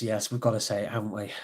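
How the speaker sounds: ordinary talk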